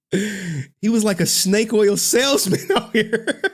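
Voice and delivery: laughing through the words, slowed down; cracking up as you say it; giggling over the meme. Real, not acted. Laughing at snake oil meme.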